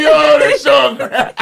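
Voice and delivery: high, loud talk breaking into laughter; retold laughing fit, slow and high-pitched; cracking up. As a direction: laugh helplessly through the words. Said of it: "I start cracking up like".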